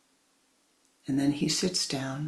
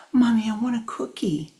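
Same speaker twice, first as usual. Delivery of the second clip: put-on child nagging; 6 semitones higher, 5 dB louder than usual.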